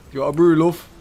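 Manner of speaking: bigger Manchester mum. Pretend. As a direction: push the mum voice up hard, calling out the offer. Repeats mum offer, bigger.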